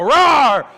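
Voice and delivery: hammed-up playful growl; playful monster roar. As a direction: roar like a pretend monster chasing children. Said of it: "and I'll go".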